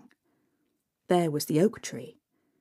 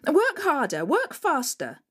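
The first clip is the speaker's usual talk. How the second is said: wicked stepmother; 7 semitones higher than usual. Acted. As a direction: bark orders at the girl.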